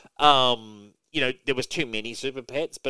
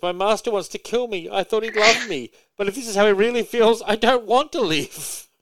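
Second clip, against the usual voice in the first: mock sad Crypto; 11 semitones higher, 7 dB louder than usual. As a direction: wail it like a heartbroken cartoon pup. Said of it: "it's kinda sad".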